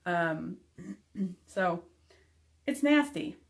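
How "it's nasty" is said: disgusted. Real, not acted. Disgusted at poop talk.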